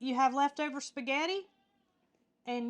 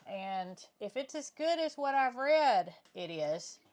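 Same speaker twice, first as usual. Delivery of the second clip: normal talking voice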